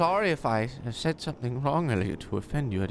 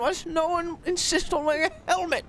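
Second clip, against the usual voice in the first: put-on fretful old lady; 13 semitones higher than usual. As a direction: beg the officer like a worried granny. Old lady pleading with officer.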